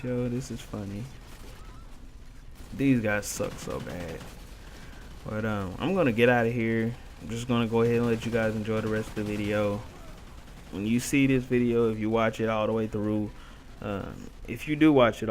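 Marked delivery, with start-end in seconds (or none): none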